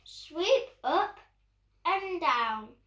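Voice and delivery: ordinary delivery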